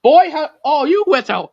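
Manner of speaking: mock indignant outburst. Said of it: "I go".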